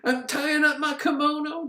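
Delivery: high, indignant mock huff; acted anger. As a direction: announce it in a hammed-up temper. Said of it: "She, she's angry".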